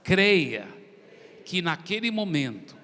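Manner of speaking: slow phrases with long pauses; led prayer. Real, not acted. Preacher leading the congregation in prayer, solemn and measured.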